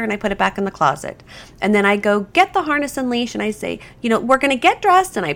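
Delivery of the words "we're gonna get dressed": much higher, bright puppy-party cheer; really fun voice. Shown as a demonstration. Trainer hands out kibble to the dog, high excited cheer. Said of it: "I say it in a really fun voice".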